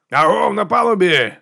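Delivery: high, drawn-out hail; calling out. A narrator's reading. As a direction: hail a ship across the water. Hailing the other ship's deck.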